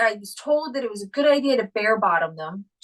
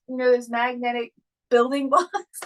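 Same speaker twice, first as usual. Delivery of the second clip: ordinary talk, nothing marked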